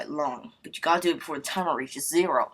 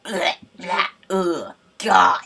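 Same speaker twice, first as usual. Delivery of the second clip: loud, retching revulsion; mock disgust; 3 semitones higher, 11 dB louder, much slower than usual. Reacting to what he saw people getting, loudly disgusted. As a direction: gag loudly on each exclamation.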